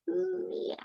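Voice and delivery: high, quiet meow; a small gentle meow; cat's soft meow. Acted. Cat meows.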